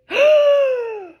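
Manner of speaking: sharp high gasp; real loud gasp; shocked gasp. Real, not acted. Shock at the drawn card.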